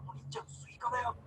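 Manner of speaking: excited whisper between siblings; hushed whisper. Retold or imitated. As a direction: whisper so the parents won't hear. Brothers whispering at night.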